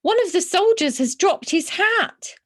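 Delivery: put-on high, excited child's voice; a kid thrilled by his discovery; small boy. Small boy tells his mother, high and excited. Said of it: "said a small boy".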